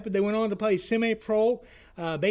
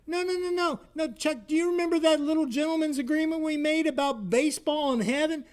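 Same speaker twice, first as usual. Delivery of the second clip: much higher, cheery call from beyond; dead buddy calls his friend from heaven, voice pitched high; ghostly high friend on phone; 8 semitones higher than usual. Acted. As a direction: greet him high and bright like a ghost on the line. Bud reassures from heaven.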